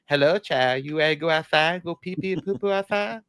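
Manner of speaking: put-on a light baby talk that climbs high at the end. Owner asking his dog about going out, in mild baby talk. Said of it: "ooey gooey baby voice".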